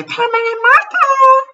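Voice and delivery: shrieked high and loud; shout. Retold or imitated. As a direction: yell it like a crowd of kids. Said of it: "Please never do that again. I think you killed me".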